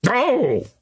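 high-pitched cry of "Oh"; exclamation. A narrator's reading. Skipper reacting to what he is told, exclaiming. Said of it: "exclaimed the skipper".

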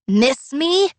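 high mock zombie shriek. A narrator's reading. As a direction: shriek it like a ghoul. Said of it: "she shrieks".

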